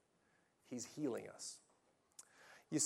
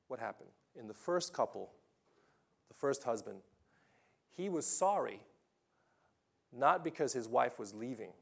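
ordinary talk, nothing marked

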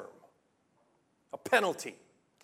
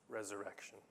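Resurrection softer and lower, dropped almost to a murmur; hushed repeat; 7 semitones lower, 13 dB quieter, faster than usual. Shown as a demonstration. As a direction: repeat Resurrection quietly so it sinks in. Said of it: "it's the Hebrew word for".

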